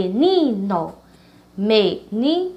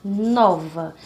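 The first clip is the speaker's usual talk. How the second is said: "nova" slowly, with a long strong "no"; stress on first syllable; 3 semitones lower than usual. Shown as a demonstration. Teacher giving an example word, first syllable stressed in "nova". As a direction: lean hard on the "no" of "nova". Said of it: "é a mais forte".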